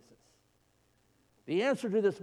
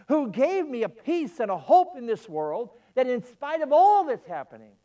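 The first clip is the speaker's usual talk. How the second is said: excited; 7 semitones higher, 5 dB louder than usual. Acted. Preacher acts excited believer.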